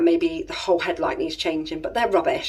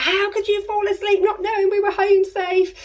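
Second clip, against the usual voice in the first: angry scolding; 12 semitones higher than usual. Retold or imitated. Wife tearing into her sleepy husband, high furious scolding. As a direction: tear into him, outraged. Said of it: "tore him a new bumhole".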